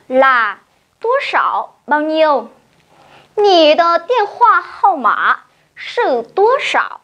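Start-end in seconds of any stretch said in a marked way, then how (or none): 3.4-7.0 Nǐ de điện thoại hao ma sư tố sảo asked slowly in Mandarin; Mandarin question. Shown as a demonstration. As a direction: model the full question slowly. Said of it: "cô sẽ hỏi như sau".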